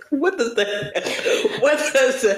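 high, helpless laughter through the words; real laughing disbelief; laughing hard. Real, not acted. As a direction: crack up while trying to ask. Cracks up at hairy baby theory.

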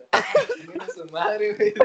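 a high, loud fed-up exclamation; exasperated outburst. Real, not acted. Recalling friends who egged him on, high outcry. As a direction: throw it out half-laughing, fed up.

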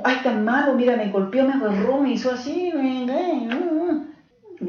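half-mocking victim whine rising in pitch; mock whiny complaint. Counselor imitating patient complaining about husband, whiny.

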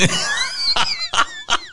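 big shrieking burst of laughter; dad cracking up at the memory. Real, not acted. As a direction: burst out cackling.

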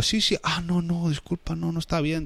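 camarero nervioso, voz más aguda; camarero apurado. Retold or imitated. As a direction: imita al camarero que se disculpa. Imita al camarero pillado. (camarero apurado, voz más aguda)

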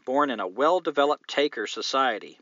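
ordinary talk, nothing marked

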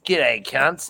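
a spokesman opening his own ad for the listeners; bogan whiskey ad. Pretend. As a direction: hail the crowd like a cheeky booze ad. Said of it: "ultimate Aussie fucking bogan spokesperson".

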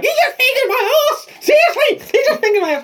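very high, laughing yell; hammed-up outraged squeal, laughing through it; mock panicked shriek. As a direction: shriek it like you can't believe it. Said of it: "And you were like".

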